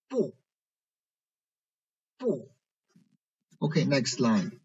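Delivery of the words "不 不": 不 in fourth tone, short high fall; tone drill. Shown as a demonstration. Teacher reading for students to circle, 不 falling. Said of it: "focus on the tones".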